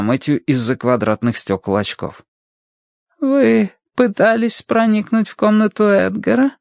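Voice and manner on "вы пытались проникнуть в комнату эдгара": thin, high, nagging voice; Adam Wilson. A narrator's reading. Financier accuses Matthew of trying the door, thin nagging voice. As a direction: accuse him in a wan, high-pitched voice. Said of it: "Голос был тощий и вялый".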